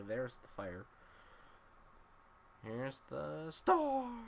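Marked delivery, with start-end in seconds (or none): 2.7-4.2 slow, loud, high reveal; dramatic announcement. Real, not acted. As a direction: announce it like a showman. Announcing the firestorm attack.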